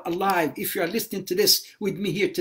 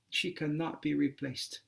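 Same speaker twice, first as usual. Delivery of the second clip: hushed; 4 semitones lower, 10 dB quieter than usual. Acted. Emotional point about mothers.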